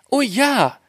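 high, eager little reply. A narrator's reading. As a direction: agree in a high girlish voice. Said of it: "sagte Schneewittchen".

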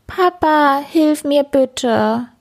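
high, slow whimpering plea; pitiful mewling plea. A narrator's reading. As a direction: kitten stuck, begging for help. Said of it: "maunzt er kläglich". (klägliches, hohes Maunzen)

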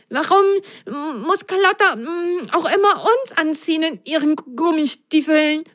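high, sulky little sock voice; grumbling. Acted. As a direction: whine about always being chosen. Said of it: "maulte Pitsch". (maulende hohe Sockenstimme)

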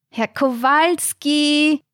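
high, slow, drawn-out call; shrill nurse. Acted. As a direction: wake him with a piercing sing-song. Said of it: "die schrille Stimme von Pflegerin Mariella".

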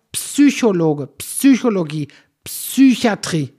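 Psychologe, Psychologie, Psychiatrie each with a loud PS; punched P-S in Psychologe, Psychologie, Psychiatrie; corrective articulation. Shown as a demonstration. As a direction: teach the PS at the start of Psychologe. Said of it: "Das ist doch nicht so schwer".

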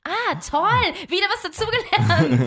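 high, loud sarcastic cheer; mock delight, laughing. Real, not acted. Sarcastic remark after confusion.

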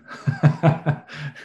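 real amused laugh. Real, not acted. Host coping with Annette's mic trouble, laughing. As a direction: laugh it off lightly.

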